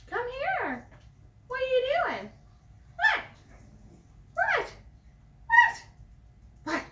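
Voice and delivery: squeaky high pet voice, very high on each "What?"; high dog-talk call. Real, not acted.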